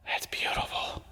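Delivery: hushed, breathy whisper. Real, not acted. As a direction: whisper it in awe. Aside after the oohs.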